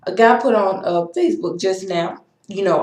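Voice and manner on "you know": ordinary talk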